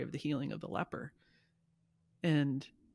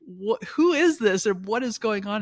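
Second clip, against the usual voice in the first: high, loud, slow alarmed "who is this?"; bewildered family on the phone, calling out; startled shout; 7 semitones higher, 10 dB louder than usual. Retold or imitated. Family startled by a laughing call.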